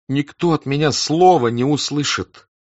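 pleading. A narrator's reading. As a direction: beg them to believe you. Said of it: "умоляющим голосом проговорил Ростов".

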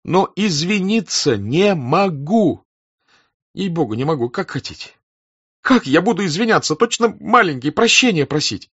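high, distressed protest; desperate plea, voice pitched high; pleading. A narrator's reading. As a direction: implore them in agitation. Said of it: "умоляющим голосом проговорил Ростов".

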